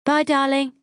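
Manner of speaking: high, sustained farewell call; high singsong parting call; called-out goodbye. A narrator's reading. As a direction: call goodbye through the house. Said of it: "then add a".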